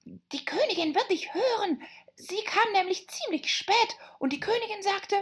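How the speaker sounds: high, urgent rabbit whisper; fearful whisper. Acted. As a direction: whisper a frightened warning. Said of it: "flüsterte das Kaninchen furchtsam".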